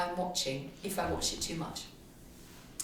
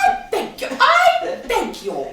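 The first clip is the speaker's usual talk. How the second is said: much higher and louder, slow prim gasp; mock outraged operator; 11 dB louder, much slower than usual. Host plays a shocked phone-line lady, much higher and louder. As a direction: play a scandalised matron.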